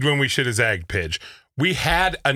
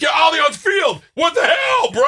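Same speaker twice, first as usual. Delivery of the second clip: New York cabbie; 12 semitones higher, 5 dB louder than usual. Retold or imitated. Host imitating the New York driver complaining about soccer, loud and high. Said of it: "he's from New York".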